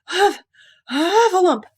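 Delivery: high, loud panting bursts; out of breath. A narrator's reading. Piglet just ran to Christopher Robin, breathless panting. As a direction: gasp the word out in pieces between breaths. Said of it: "breathing so hard that he could hardly speak".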